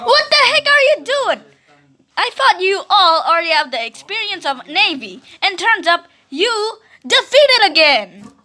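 high, loud angry scolding; furious commander. Acted. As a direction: chew out the officer. Commander yelling at losing officer.

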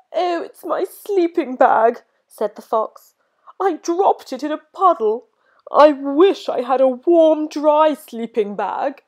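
high, tearful fox voice; a fox near tears; crying fox. A narrator's reading. Fox telling George about the wet bag, tearful. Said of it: "a fox who was crying".

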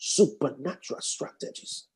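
preacher's hushed emphasis; whisper. Acted. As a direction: drop to a whisper. Repeat dropped to whisper.

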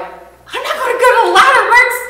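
high laughing protest; laughing refusal. Retold or imitated. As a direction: squeal it out, laughing at the idea. Refusing to ask the neighbor.

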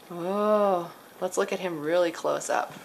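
ordinary delivery

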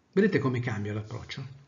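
normal talking voice

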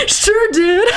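high voice through laughter; hammed-up delighted bro; giddy acceptance. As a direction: accept giddily, laughing as you speak. Acted bro accepts the invite.

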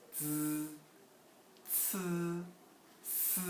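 즈, 츠, 스 drawn out and level, tongue flat against the teeth; flat-tongue sibilants. Shown as a demonstration. Teacher shows tongue placed at teeth, flat-tongue sibilants. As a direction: hold each of 즈, 츠, 스 long and even. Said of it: "혓바닥을 굉장히 이렇게 편 상태에서".

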